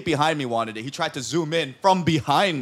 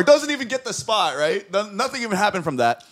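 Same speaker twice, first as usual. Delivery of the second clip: ordinary talk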